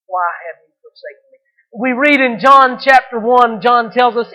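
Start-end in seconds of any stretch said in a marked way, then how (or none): 0.1-1.4 soft, low repeated question; hushed lament. Retold or imitated. Preacher voicing Jesus to God his Father, hushed lament. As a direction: ask it low and very quietly.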